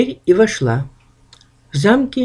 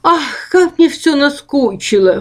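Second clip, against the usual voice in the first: complaining ghost; 9 semitones higher than usual. Acted. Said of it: "вторило ему привидение".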